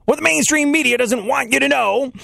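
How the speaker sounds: a lightly voiced talk radio host; Rush Limbaugh impression. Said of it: "I've got Rush Limbaugh".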